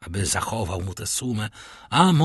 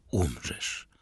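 low, hushed doom; ominous hushed word; whispered warning; 7 semitones lower, 7 dB quieter than usual. A narrator's reading. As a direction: drop to a soft, low murmur. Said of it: "które szepce mu".

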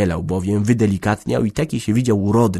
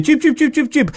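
calling chickens; 5 dB louder than usual. Acted.

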